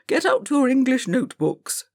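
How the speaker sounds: put-on stern old schoolmaster shouting; shouted order. A narrator's reading. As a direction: drive the class to get their books. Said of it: "shouted Mister Greville".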